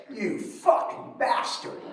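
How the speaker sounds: slow, breathy hiss of rage; mother cursing her son in a slow hiss; mother's curse. Retold or imitated. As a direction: spit the curse out at your son under your breath. Mother slams fist, points finger.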